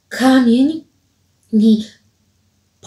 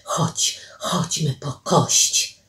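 slow, soft, low whisper; urgent coaxing whisper; 4 semitones lower, 7 dB quieter, slower than usual. Acted. Alicja pulls Paweł to the bone, whispering. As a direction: coax under the breath, drawing it out.

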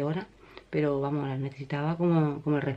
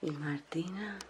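stage whisper. Real, not acted. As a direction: whisper so no one wakes up. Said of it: "Hablo bajito".

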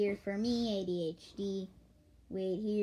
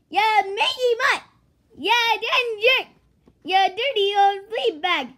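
loud, higher bursts, then easing slightly; hammed-up furious insults; 11 semitones higher, 12 dB louder than usual. Guard yells names at the escaped dog, hammed-up fury. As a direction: hurl each insult like a cartoon grump.

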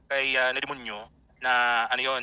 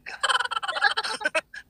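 long high laugh. Real, not acted. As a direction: let a high laugh run. Laughing about the tutorial.

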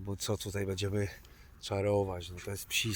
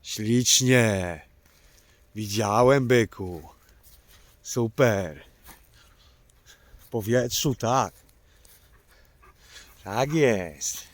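loud praise; 5 dB louder, much slower than usual. Real, not acted. Praising dogs loudly outdoors.

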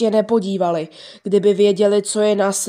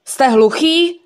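irritated father; 5 semitones higher, 4 dB louder, slower than usual. Acted. Father scolds boys.

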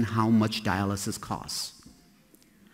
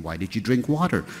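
usual speaking voice

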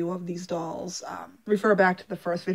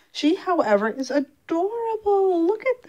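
higher, slow gush of delight; delighted cooing, much higher; 10 semitones higher, 3 dB louder than usual. Real, not acted. Gushing over the new doll.

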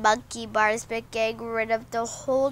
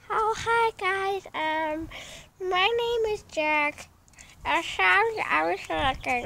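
lightly voiced pet dog, higher; 6 semitones higher than usual. Voicing the dog Jack.

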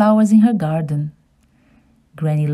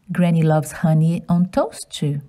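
ordinary delivery

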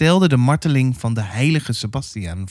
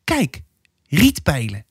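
put-on clear child's voice; slower than usual. A narrator's reading. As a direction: point it out clearly, like a small girl. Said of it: "zei helder". (heldere kinderstem)

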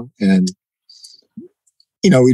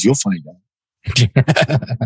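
mysterious tease; much slower than usual. Retold or imitated. Friend's mysterious teasing reply.

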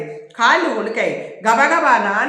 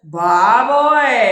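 long drawn-out, louder cry; loud wail; 6 dB louder, much slower than usual. A narrator's reading. Rowdy crying out as he slips on the peel, loud wail. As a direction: stretch the cry out slowly at raised volume. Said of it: "గట్టిగా గావుకేక వేశాడు వీరు".

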